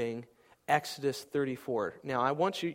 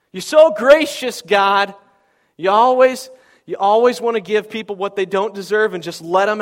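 loud, much higher furious rant; raging at God; 7 semitones higher, 13 dB louder than usual. Acted. Jonah raging at God for sparing Nineveh, furious shout. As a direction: hurl the accusation at God. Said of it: "he's raging at God".

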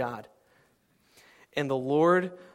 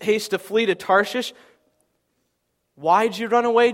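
louder, higher pleading complaint; Jonah's bitter prayer; 7 semitones higher, 11 dB louder than usual. Acted. Jonah complaining to the Lord, raised bitter plea. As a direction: pour out the grievance to God.

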